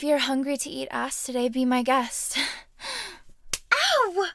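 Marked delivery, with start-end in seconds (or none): 3.7-4.3 loud, high yelp of "ow". Acted. Woman reacts to her partner, loud yelp. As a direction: cry out sharply, pitched well up. Cries out in play.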